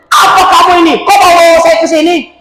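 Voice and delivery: angry scolding. Retold or imitated. Angry old woman scolds helper.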